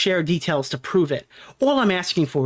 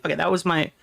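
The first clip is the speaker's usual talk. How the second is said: ordinary delivery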